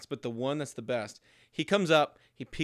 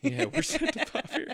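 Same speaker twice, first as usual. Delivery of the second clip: laughing. Retold or imitated. Echoing quote laughing.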